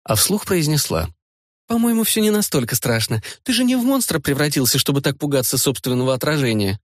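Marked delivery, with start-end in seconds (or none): 1.7-6.8 much higher teasing female voice; young woman voice. Acted. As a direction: play the young woman, amused. Rita speaking aloud.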